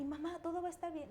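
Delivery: lightly voiced daughter comforting mom; daughter's voice. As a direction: rattle it off like a small girl. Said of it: "mi hija me ve".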